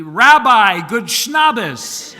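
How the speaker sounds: loud, high yell across a big room; laughing shout to the rabbi; yelled greeting. Retold or imitated. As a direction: call out across the dining hall. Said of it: "yells to me".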